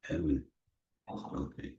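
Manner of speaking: fading into a whispered Everything is okay. Acted. Leader whispering the last reassurance to the group. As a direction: breathe the words out with no voice.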